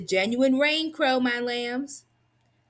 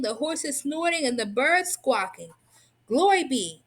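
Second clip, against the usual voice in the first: usual speaking voice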